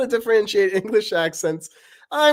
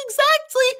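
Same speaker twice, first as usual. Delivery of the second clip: very high fussy lecturing; Paper's voice; 7 dB louder than usual. Acted. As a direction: nitpick in a squeaky voice. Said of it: "Paper and Knife talking".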